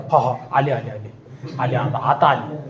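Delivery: low, hushed mutter of dread; nervous murmur. A narrator's reading. Khan hears the war cry and mutters in low dread. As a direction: mutter it low, as if the enemy is at the gate.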